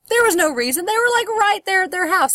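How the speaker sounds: high, loud disbelief; outraged at the pointless scene; indignant outburst. Real, not acted.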